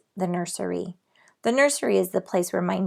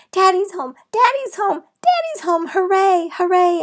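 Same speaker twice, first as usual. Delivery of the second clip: very high, loud happy cheers; joyful yelling; 11 semitones higher, 7 dB louder than usual. A narrator's reading. Girl cheers as her dad arrives. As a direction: cheer as Daddy pulls in. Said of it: "I yelled".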